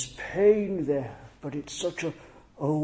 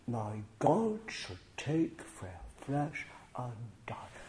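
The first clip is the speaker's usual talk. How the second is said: soft, almost whispered plea; hushed humble prayer; 4 semitones lower, 10 dB quieter than usual. Pretend. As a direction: drop to a near whisper, awed and small.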